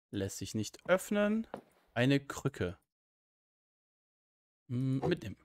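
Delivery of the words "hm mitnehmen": ordinary talk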